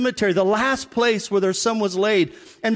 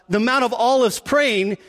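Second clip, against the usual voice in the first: normal talking voice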